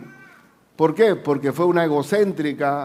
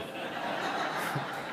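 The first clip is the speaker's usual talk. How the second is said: risa; 14 dB quieter than usual. Real, not acted. Risa tras tamarindo.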